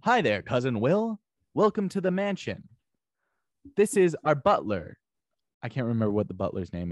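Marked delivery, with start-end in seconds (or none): 0.0-5.0 slow, measured host's welcome; Carlton Banks impression. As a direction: introduce the household slowly in a put-on character voice. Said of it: "Carlton Banks kinda sounds like this".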